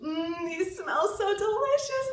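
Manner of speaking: put-on high, eager kids savoring; excited child voice. A narrator's reading. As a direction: say it like hungry children.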